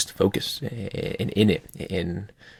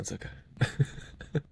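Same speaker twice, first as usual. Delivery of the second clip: whispered, much softer and very slow; playful whisper; 6 semitones higher, 8 dB quieter, much slower than usual. Pretend. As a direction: hush it like a shared secret, slow and soft. Joking secret, no one listening.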